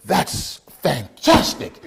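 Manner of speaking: put-on very slow, delighted Jamaican accent; old-school Jamaican man. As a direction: stretch it out with a Jamaican lilt. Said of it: "old school Jamaican man".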